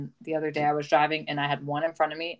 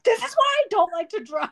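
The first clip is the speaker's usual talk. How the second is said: whiny voice; 4 dB louder than usual. Retold or imitated. Whiny self-quote while driving.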